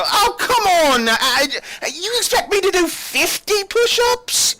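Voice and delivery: very high, loud complaining guard; whiny guard. Acted. Guard protesting push-ups to the captain, high and whiny. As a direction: whine like a lazy soldier.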